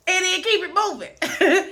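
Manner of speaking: high, loud sassy flourish; sassy sign-off. Real, not acted. Telling viewers who dislike her to move on, sassily. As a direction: toss it off with attitude.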